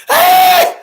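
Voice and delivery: extremely high, very loud shriek; mock shriek. Imitating a woman in a Jeep yelling at him, shriek. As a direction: screech like she's startled. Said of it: "she goes".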